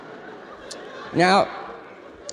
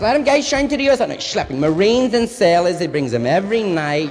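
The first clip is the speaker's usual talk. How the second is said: fast, loud Yiddish mother; Yiddish accent; 4 semitones higher, 7 dB louder than usual. Retold or imitated. Mother voiced in story.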